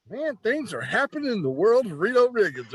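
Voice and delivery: high, thrilled self-talk; excited inner voice. Retold or imitated. Thrilled rookie cheering himself on after meeting Flair, high and excited. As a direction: pump yourself up like a giddy rookie. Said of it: "So I was like".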